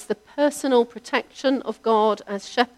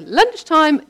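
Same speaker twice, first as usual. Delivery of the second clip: louder, higher call with a falling end; mum's call to the sheep; 7 semitones higher, 7 dB louder than usual. Retold or imitated. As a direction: call the flock like mum does. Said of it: "When my mum would go down and go".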